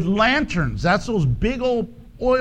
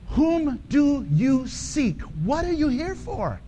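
searching question; 4 semitones higher, 3 dB quieter than usual. Real, not acted. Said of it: "searching question to congregation".

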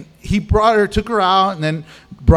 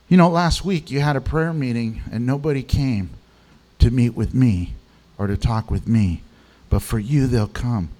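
gentle voice of the Lord; 7 semitones lower than usual. Retold or imitated. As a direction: speak it lovingly, lower. The Lord speaks to her.

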